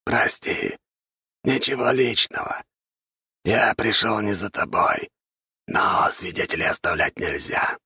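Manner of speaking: raspy undead whisper; skeleton voice. A narrator's reading. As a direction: hiss it like a skeleton. Skeleton voice in story.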